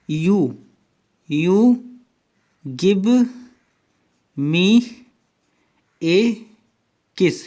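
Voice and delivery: each word said apart, with long pauses; slow spaced words. Shown as a demonstration. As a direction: drop one word at a time, slowly. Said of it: "इसको बहुत तेज तेज बोलिए". (शब्द-शब्द रुककर धीरे बोलना)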